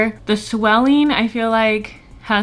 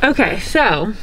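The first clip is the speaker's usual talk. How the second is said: ordinary delivery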